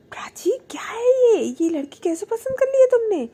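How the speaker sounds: high, loud mock outrage; aunt loudly complaining about the bride; exasperated exclaiming. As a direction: throw up your hands at her. Boochi exclaiming about the girl.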